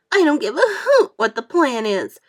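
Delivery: loud, high tearful outburst; upset worm wailing; crying out. A narrator's reading. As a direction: near tears, refusing loudly. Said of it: "cried the earthworm".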